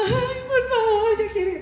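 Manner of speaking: high, slow, sing-song plea; begging wife. Retold or imitated. Mother pleading with father to go dancing, high sing-song whine.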